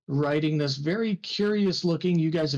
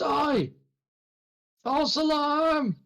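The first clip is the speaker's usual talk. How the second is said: high, slow called-out correction; guard yelling back; 13 semitones higher, slower than usual. Acted. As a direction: shout down that it's only a gnome. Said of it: "the guard yells back and says".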